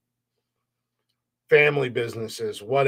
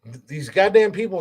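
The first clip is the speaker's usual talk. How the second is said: normal talking voice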